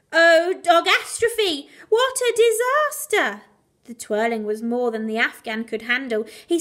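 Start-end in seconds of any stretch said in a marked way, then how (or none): 0.1-3.4 loud, high, slow cry of dismay; dramatic dismay. A narrator's reading. Narrator gasps at the disaster, loud and slow. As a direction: wail it like a catastrophe.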